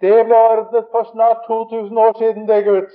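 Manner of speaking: loud, high, full-voiced proclamation; triumphant shout. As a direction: bellow it across the street. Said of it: "jeg roper Vognan Hansen". (høyt, ropende og forkynnende)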